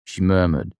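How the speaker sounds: ordinary delivery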